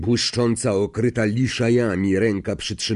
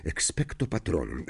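softly repeated; 6 semitones lower, 8 dB quieter than usual. Acted. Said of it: "powtórzył szeptem Harry".

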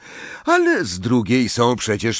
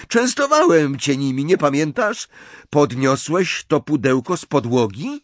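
higher-pitched insistence; heated argument; 6 semitones higher, 3 dB louder than usual. A narrator's reading. Boys quarrelling over chocolates, heated. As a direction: press the point with a raised voice.

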